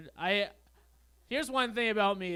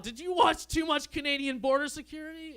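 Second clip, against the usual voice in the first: higher, shouted mock outrage; pretend yell; 8 semitones higher, 4 dB louder than usual. What he should have yelled at his girlfriend, mock shout. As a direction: yell it like a fake accusation.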